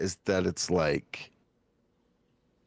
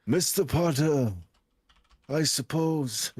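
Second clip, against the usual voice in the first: put-on high, slow, laughing old wizard; Dumbledore impression; 7 semitones higher, much slower than usual. Shown as a demonstration. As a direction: play a kindly old headmaster. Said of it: "start sounding like Dumbledore".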